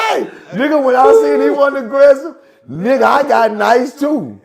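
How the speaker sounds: loud, high, amused outburst; shouting amid laughter. Real, not acted. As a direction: holler the punchline while cracking up. Shouting punchline over laughter.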